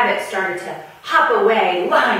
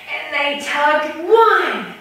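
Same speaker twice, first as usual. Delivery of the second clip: slow, higher, louder counted heaves; straining tug; 11 semitones higher, 5 dB louder, slower than usual. Acted. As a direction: pull hard on each number. Animals pulling the lion's tail.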